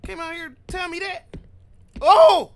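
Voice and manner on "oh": sudden loud high yelp of fright; real startled outburst; startled shout. Real, not acted. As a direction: jump at the noise and cry out. Sudden scare.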